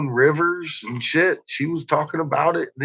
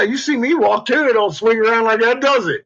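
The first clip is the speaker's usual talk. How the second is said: usual speaking voice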